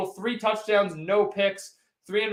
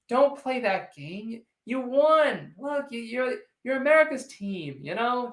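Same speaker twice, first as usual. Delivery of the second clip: mock consoling; 4 semitones higher, slower than usual. Teasing a Cowboys fan in chat.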